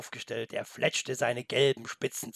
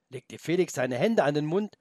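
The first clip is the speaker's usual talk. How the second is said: plain reading voice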